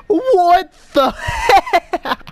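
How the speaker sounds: high squeal breaking into laughter; laughing. Real, not acted. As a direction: laugh through the words. Laughing at new pirate ship.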